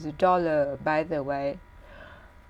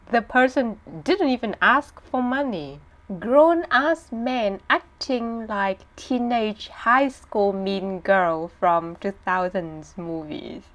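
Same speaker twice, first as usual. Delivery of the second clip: usual speaking voice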